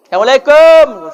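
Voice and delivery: mother-in-law announcing herself at the door; greeting at door. Retold or imitated. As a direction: hail the house as you arrive.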